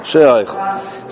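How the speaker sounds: she oichel, the "she" held long and slow; elongated shin. Shown as a demonstration. Teacher showing students the drawn-out "she". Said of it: "he elongated the shin".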